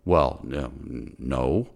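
a hammed-up shrinking no from the pharmacist; meek pharmacist. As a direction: imitate a cornered clerk mumbling no. Pharmacist cornered in retold argument.

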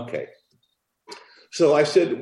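ordinary delivery

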